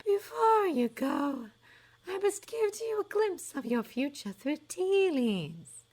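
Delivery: high, slow, dreamy prophetess; a high airy fortune-teller sizing up a doubter; misty seer voice. A narrator's reading. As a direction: float it like a mystic seer. Trelawney offers tea reading.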